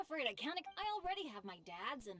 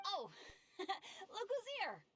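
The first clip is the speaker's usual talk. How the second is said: laughing; 9 semitones higher, much slower than usual. Acted. Cheerful greeting with laugh.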